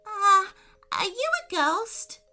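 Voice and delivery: put-on high, hesitant girl's question; puzzled child, pitched right up; confused. A narrator's reading. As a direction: blurt a loud "uh", then ask high. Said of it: "felt a little confused".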